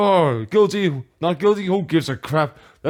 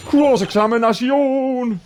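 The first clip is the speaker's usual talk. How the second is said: slow, high proclamation; dramatic announcement; 6 semitones higher than usual. Acted. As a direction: announce it like a courtroom call. Dramatic game call-out.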